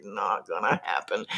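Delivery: breathy whisper of giving up; whispered despair. Retold or imitated. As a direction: whisper it like you're about to quit. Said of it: "giving up and saying".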